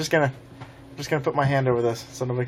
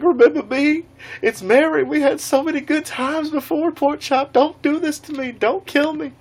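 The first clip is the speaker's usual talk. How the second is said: hammed-up high voice begging for mercy; high-pitched pleading; 13 semitones higher than usual. Mary the counselor begging Jason for his life, high mock pleading. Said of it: "he was like".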